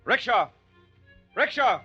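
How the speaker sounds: high loud street call. Acted. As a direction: shout for a rickshaw. Street calling out.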